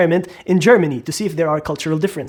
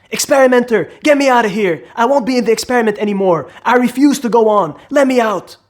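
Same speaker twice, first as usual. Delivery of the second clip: higher-pitched protest, demanding release; acted crying out; 5 semitones higher, 3 dB louder than usual. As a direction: call out as the protesting learner. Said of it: "he cries out".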